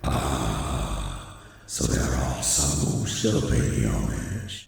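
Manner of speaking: very low, slow raspy growl; ominous demon statue. Acted. Demon statue to the one who hears it, ominous. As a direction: speak as an ancient, breathy demon.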